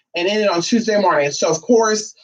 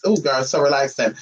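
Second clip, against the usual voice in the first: normal talking voice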